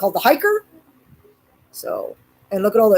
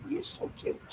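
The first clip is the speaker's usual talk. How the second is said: whispered coo; 9 semitones higher, 17 dB quieter than usual. Real, not acted. Owner whispering to her dog, soft coo. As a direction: breathe it softly at her.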